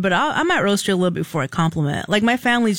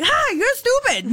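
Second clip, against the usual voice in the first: much higher, slower mock jab; mother's playful tease; 13 semitones higher, slower than usual. Woman imitating her mother reacting to a joke, high playful tease. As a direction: imitate a mom teasing. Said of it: "My own mother would be like".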